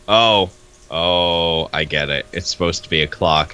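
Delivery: ordinary talk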